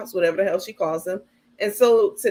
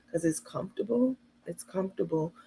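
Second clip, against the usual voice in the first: meek, much softer mock reply; docile; 2 semitones lower, 8 dB quieter than usual. Carmen meekly answering critical Tay, soft and docile. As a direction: shrink the words down, obedient. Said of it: "All docile and".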